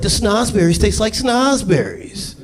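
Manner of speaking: put-on dreamy little girl tasting; mock little girl. Little girl in the chocolate factory, slow wonder. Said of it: "Little girl's like".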